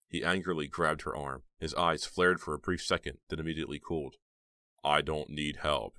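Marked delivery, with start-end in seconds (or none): none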